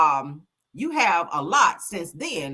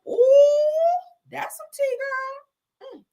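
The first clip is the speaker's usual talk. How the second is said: very high, squealed gossip reaction; long high "ooh" over juicy gossip; scandalized delight; 4 dB louder, much slower than usual. Real, not acted. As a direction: squeal it like hot tea just dropped. Reacting to juicy gossip just read.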